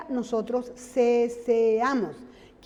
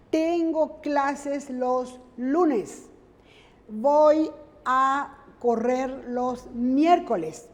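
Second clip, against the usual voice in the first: very slow, each word laid out for students; slow example reading; much slower than usual. Shown as a demonstration. Teacher reading example sentences to students, very slow. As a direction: read "Tengo clases los lunes" slowly for the class. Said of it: "teacher reads example sentences slowly".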